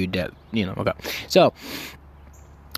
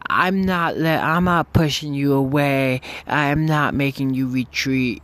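higher, mildly mocking mom voice; retold protest; 4 semitones higher than usual. Girlfriend's mom insisting to her daughter, retold protest. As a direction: imitate the mom protesting, pitched up.

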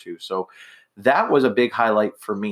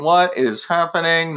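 bewildered question, higher and stretched out; mock bewilderment; 5 semitones higher, slower than usual. Imitating a baffled onlooker at the awards, bewildered. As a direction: draw it out slowly in imitated disbelief. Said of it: "everyone bewilderment".

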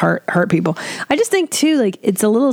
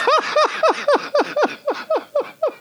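high laugh. Real, not acted. Laughing at own retelling.

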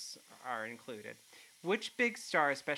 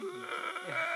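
retching gag sound; gagging. Real, not acted. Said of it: "Max is gagging".

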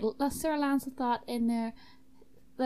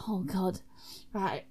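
ordinary talk

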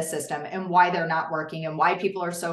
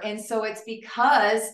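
usual speaking voice

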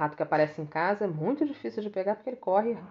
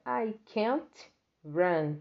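very slow, words spaced apart; slow model sentence; 3 semitones higher, much slower than usual. Shown as a demonstration. Teacher reading the bird's line to students, very slow. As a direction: say each word on its own. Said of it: "A segunda frase que a mesma ave tá falando é".

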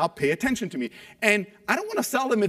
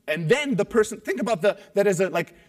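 ordinary talk

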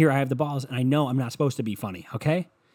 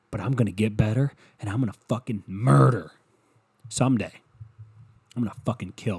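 normal talking voice

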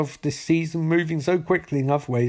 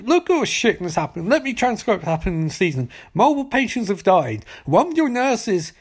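higher, ranting in mock fury; angry governor rant; 5 semitones higher than usual. Governor berating the doctor, higher, mock fury. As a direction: berate him like an outraged governor. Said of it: "turns Doctor Hangover and says".

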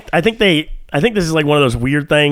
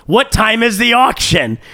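higher, slow, pressing "What time is the auction?"; all caps question; 7 semitones higher, slower than usual. Retold or imitated. Asking the scammer, an all-caps slow question. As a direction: spell the caps out with weight. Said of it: "in all caps".

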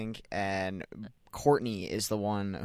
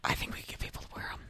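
soft whispered ASMR voice; 6 dB quieter, faster than usual. Pretend. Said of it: "A S M R style".

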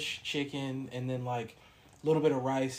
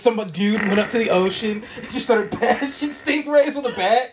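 laughing; 5 semitones higher, 8 dB louder than usual. Real, not acted. Laughing through the story.

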